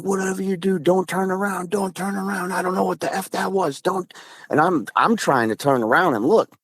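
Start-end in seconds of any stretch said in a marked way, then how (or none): 0.0-4.1 high, softened imitation of a screaming friend; mock scream held back in volume, urgent and high-pitched; retold panicked scream. Friend yelling not to turn around as they flee, mock panicked scream. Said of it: "and he starts screaming".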